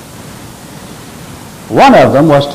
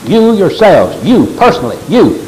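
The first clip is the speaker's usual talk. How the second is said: each "you" punched and set apart; high, pointed stress on the first "you"; emphatic YOU; 4 semitones higher than usual. Shown as a demonstration. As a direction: hit "you" hard in "you yourselves, you personally, you".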